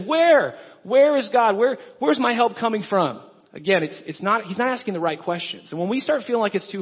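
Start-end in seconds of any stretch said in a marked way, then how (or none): 0.1-3.2 higher and louder, pleading where; desperate Gideon. Acted. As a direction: cry out like a man who feels abandoned. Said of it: "He says".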